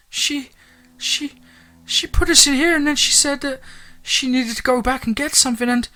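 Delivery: tiny high-pitched whimper; halting, very high little-kid voice on the verge of tears; whimpering child. A narrator's reading. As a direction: whimper it out like a scared small child. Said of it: "Ty said, whimpering".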